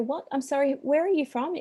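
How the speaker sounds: mildly baffled, asking who is calling; puzzled call recipient. Retold or imitated. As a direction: imitate someone caught off guard on the phone. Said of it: "And you could hear them go".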